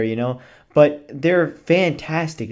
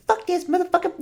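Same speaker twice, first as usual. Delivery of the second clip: put-on much higher squeaky kid voice with a laugh in it; mock foul-mouthed kid; faster than usual. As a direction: play the cursing kid, giggling through it. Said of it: "They're like, you know".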